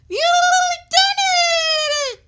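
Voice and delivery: very high excited shout. Acted. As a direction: Fish squeals with delight. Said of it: "shouted Fish".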